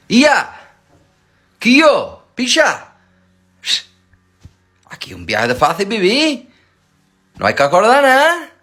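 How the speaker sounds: loud, high, energetic rant; loud excited. Real, not acted. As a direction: shout it with excitement.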